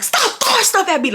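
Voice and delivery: high-pitched mock scream; retold scream. Student to classmates pelting her at P E, high mock scream. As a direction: imitate a kid screeching in protest, without real volume. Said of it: "I'll be screaming".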